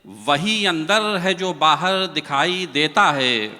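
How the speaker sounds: measured, lilting verse delivery; poem recitation. Acted. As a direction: recite it like a mushaira couplet.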